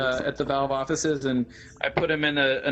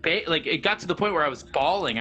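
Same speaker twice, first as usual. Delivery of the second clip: normal talking voice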